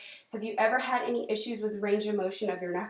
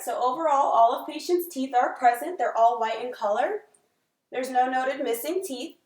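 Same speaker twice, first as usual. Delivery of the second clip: clinical dictation; 2 semitones higher, 6 dB louder than usual. Acted. Dictating exam findings aloud.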